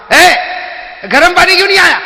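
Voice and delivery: high, loud mock complaint; angry husband. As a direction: bark at the wife, hammed-up. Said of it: "تو غصے ہوا". (غصے میں شوہر کی نقلی ڈانٹ)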